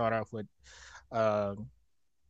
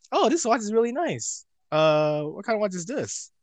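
hammy high voice; 10 semitones higher, 6 dB louder than usual. Retold or imitated. Imitating Cameron Grimes admiring watch.

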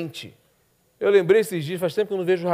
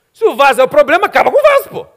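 much higher and louder, punchy; emphatic outburst; 9 semitones higher, 11 dB louder, faster than usual. Acted. Preacher voicing the guard who smashed the vase, loud. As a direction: drive the blunt logic home.

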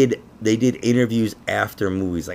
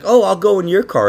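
chirpy, eager offer in a raised voice; high mock voice; 10 semitones higher, 7 dB louder than usual. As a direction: boss voice, pitched up high and loud. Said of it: "he was like".

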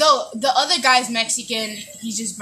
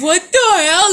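mock outrage; 6 semitones higher, 7 dB louder than usual. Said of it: "and they're like".

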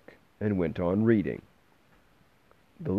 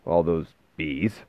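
grumbled; 2 semitones lower, slower than usual. A narrator's reading. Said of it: "the man grumbled".

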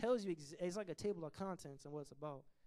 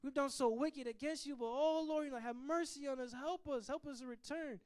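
higher, louder pleading cry to the Lord; pleading prayer; 6 semitones higher, 3 dB louder than usual. Retold or imitated. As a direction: cry out to God for mercy. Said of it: "He's crying out".

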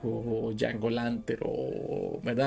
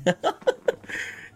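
breathy laugh; 12 semitones higher than usual. Real, not acted. Laughs at own story.

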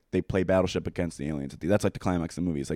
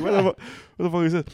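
mock bafflement; 10 semitones higher, much faster than usual. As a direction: act out dad's baffled outburst. Imitates dad baffled by wrong film.

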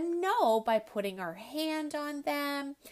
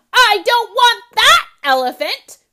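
much higher, loud tantrum shout; 10 semitones higher, 20 dB louder than usual. Acted. Ellie throwing a tantrum at her father, shouting. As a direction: scream it in a full tantrum. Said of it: "she shouted".

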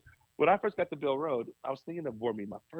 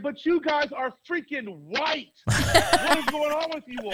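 high, exasperated retold outburst, half laughing; frustrated outburst; 11 semitones higher, 4 dB louder than usual. Pastor scolding his board, exasperated and half laughing. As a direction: replay the blow-up at the board, higher and louder with a laugh breaking in. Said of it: "I got so frustrated".

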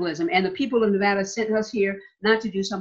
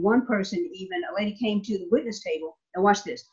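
ordinary delivery

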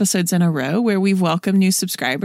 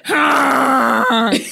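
throaty camel groan; grumbling camel voice; 4 semitones higher, 4 dB louder than usual. Acted. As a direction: bellow like a camel. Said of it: "that's a camel noise".

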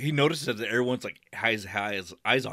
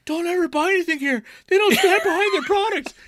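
mock scream, much higher and louder; hammed-up screaming customer; 7 dB louder than usual. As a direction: shriek it at the whole store. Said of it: "just screaming".

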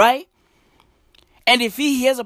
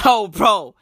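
normal talking voice

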